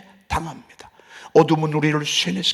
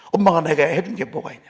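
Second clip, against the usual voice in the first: ordinary delivery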